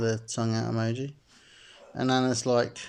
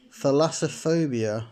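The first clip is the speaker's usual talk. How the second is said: ordinary delivery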